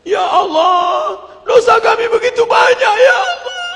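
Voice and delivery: hammed-up high, loud tearful wail; wailing prayer. Worshipper at night retreat confessing many sins, wailing. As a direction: plead to God in an acted sobbing cry. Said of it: "latihan nangis sebulan dua kali".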